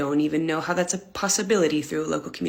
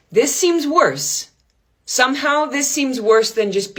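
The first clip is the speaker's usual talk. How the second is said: high, very slow dread; horrified; 8 semitones higher, 3 dB louder, slower than usual. Acted. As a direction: drag it out in mock horror. Horrified realization.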